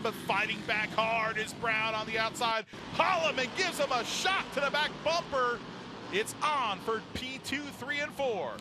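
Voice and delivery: loud, rising race call; live play-by-play. Acted. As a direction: build the hype through the bumping.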